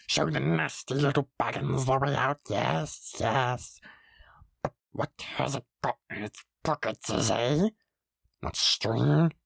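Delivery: slither the words like a cave creature; wheedling Gollum with gulping gollums. Acted. Gollum voiced in story.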